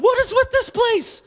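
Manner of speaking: high, laughing what is with this place; incredulous laughing question; laughing disbelief. Retold or imitated. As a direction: squeal it out in amused shock. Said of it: "I'm going".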